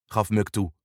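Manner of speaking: ordinary delivery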